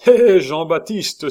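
loud high call of the name; cry out. A narrator's reading. Lecoq hails Jean-Baptiste loudly, cheerful shout. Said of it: "s'écria-t-il".

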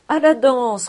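high, loud cheer; echoed cheer. A narrator's reading. The tipsy friends echo Cobus, cheering loudly. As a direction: shout it back merrily. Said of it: "répétèrent les autres".

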